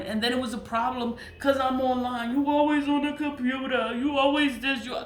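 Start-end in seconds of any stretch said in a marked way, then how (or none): 2.3-4.9 higher, nagging mimic of the ex; mock nag, pitched up; mocking partner's nagging. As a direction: throw his complaint back at him in a put-on voice. Quoting partner's complaint about computer.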